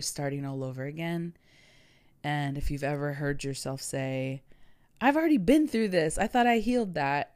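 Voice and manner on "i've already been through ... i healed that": exasperated. Retold or imitated. Quoting listener's frustrated inner voice.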